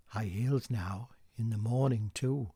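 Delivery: a gossip's hushed remark; whispered aside. Acted. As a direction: breathe it like a secret.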